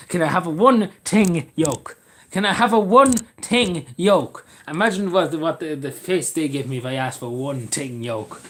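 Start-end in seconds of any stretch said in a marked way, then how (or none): 0.1-4.4 slow, deliberate, every word drawn out; acted customer request. Customer asking the shopkeeper, slow and deliberate. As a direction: spell out the odd request word by word. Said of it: "gonna be asked the shopkeeper".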